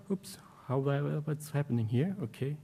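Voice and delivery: very soft, under the breath; muttered aside. Real, not acted. Presenter puzzled by what's happening, muttering quietly. Muttering over a slide glitch.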